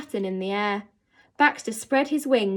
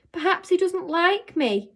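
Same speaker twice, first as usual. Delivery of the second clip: mournful; 8 semitones higher than usual. A narrator's reading. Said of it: "Novak said mournfully".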